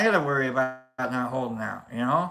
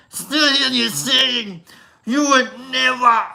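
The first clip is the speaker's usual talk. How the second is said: loud, higher Arnold Schwarzenegger singing; 8 semitones higher, 6 dB louder than usual. Pretend. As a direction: sing it like Arnold, pumped up. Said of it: "a little Russian too".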